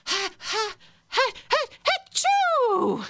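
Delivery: very high, giggling run into a sneeze; laughter sneeze. Shown as a demonstration. Coach demonstrates the laughter sneeze to the class, giggling high. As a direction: laugh your way up into a fake sneeze. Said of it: "this next one is called the laughter sneeze".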